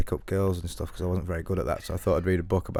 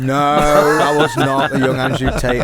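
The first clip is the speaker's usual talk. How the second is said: indignant; 4 semitones higher, 8 dB louder, slower than usual. Real, not acted. Indignant denial of friend's jibe.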